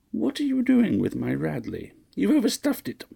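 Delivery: very high, petulant matron; high, fussy complaint. A narrator's reading. Mistress complaining to her butler about her suitcase, high and fussy. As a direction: whine it at the servant.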